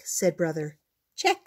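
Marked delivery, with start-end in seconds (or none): 1.2-1.5 high, chirpy little cub. A narrator's reading. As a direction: squeak it out like a tiny cub. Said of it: "said Honey".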